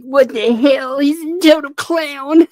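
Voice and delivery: high-pitched mock outrage; Gabe. Mimicking Gabe reacting to the clown, mock indignation. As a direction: imitate an irritated man squealing in disbelief. Acting out Gabe's irritated reaction.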